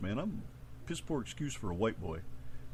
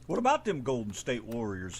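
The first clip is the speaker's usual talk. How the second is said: ordinary talk, nothing marked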